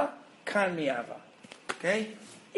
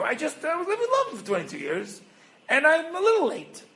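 high-pitched pleading excuse, getting louder; hammed-up excuse, pitched far up; mock excuse; 10 semitones higher, 4 dB louder than usual. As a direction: plead your case in a squeaky-high comic voice. Voices Yaakov excusing himself to Esav.

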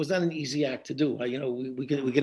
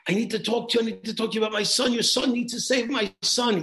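urgent plea; 6 semitones higher than usual. Retold or imitated. Father at the other father's door.

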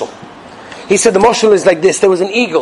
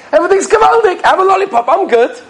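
high-pitched imitation of a cheered-up kid; delighted child; 13 semitones higher than usual. Child who got a lollipop forgets crying, mock delight. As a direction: play a child suddenly thrilled, tears forgotten.

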